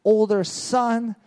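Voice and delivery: hammed-up reproach of a selfish son; father mock-scolds his older son, slow and high; mock scolding father. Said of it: "He doesn't say".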